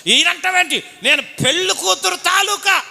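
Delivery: high, loud quarrelsome shout; drunken uncle yelling. Retold or imitated. Bride's drunk uncle yelling at the groom's uncle. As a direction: bark it back like a drunk uncle picking a fight.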